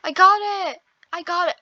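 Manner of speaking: high, loud cry of success; triumphant excitement. Real, not acted. Player finally grabbing the item, triumphant excitement. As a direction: burst out high and repeat it excitedly.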